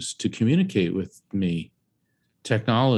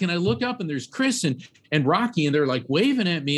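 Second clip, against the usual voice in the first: plain reading voice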